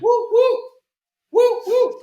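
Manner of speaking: very high, loud falsetto hoots; two pairs of silly high-pitched whoops; jingle-ending falsetto woo-woo; playful high woo-woo. Pretend. Hooting after own makeshift jingle.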